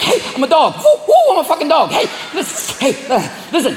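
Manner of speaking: high, jittery fake-dog yapping; coyote on crack. Coyote passes itself off as dog, high and manic. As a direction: yap excitedly, pretending to be a dog. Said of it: "like dogs on crack".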